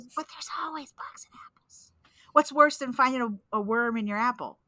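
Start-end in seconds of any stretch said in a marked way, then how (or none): 0.2-1.9 hushed echo; whispering. Pretend. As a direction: whisper the words back. Echoes friend in a whisper.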